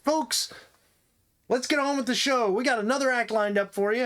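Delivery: high, bright emcee call; hammed-up announcer; game show host. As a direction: welcome the crowd like a cheesy host. Host Larry introduces act.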